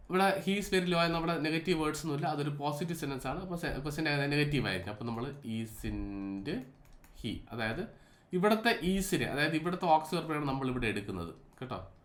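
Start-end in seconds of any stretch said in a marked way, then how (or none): none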